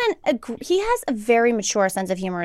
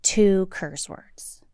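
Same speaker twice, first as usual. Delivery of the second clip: whisper; 3 semitones lower, 3 dB quieter, much slower than usual. Retold or imitated. Mock conspiratorial to kids.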